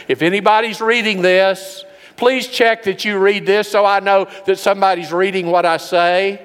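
high, loud, pleading online plea; lonely post read aloud. Retold or imitated. Lonely person begging friends online, high loud plea. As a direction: read it like a desperate status update.

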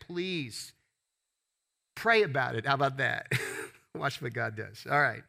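ordinary talk, nothing marked

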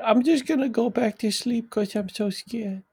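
put-on high, timid little kid voice; small frightened child; scared child voice.

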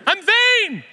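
flustered. Retold or imitated. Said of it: "You look fine".

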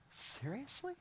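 high, breathy disbelieving question; a listener who can't believe it; incredulous. Retold or imitated.

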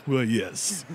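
mock-posh rich man's voice. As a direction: put on a playful well-to-do voice. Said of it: "get my rich voice".

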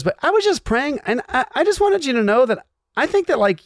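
high, gushing imitation of Desi; gushing friend. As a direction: sing it up high like a sweet churchgoer. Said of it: "She goes".

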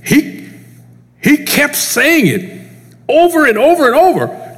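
emphatic preaching. Acted. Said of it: "preacher building emphasis".

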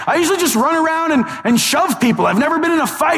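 high, awkward admission; Flash. Acted. Awkward Flash admitting inexperience to Batman, high nervous voice. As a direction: confess it in a high, unsure voice. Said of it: "he's pretty awkward".

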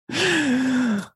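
short high laugh; a burst of amused laughter; real laugh before answering. Real, not acted. Laughs at the next question.

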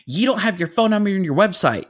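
loud, high accusing yell; imitated screaming. Planner complaining about a missing number, screaming. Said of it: "continued to scream at me".

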